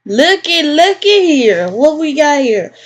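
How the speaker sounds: gleeful snoop. Acted. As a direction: savor catching someone out.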